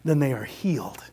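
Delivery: soft, whispered promise; hushed. Real, not acted. Preacher telling the congregation, hushed and tender. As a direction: drop to a near whisper.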